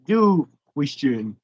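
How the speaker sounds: "question" drawn out oddly; slow, strangely said "question"; odd pronunciation. Real, not acted. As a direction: stretch "question" slowly into a weird shape. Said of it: "said that weird".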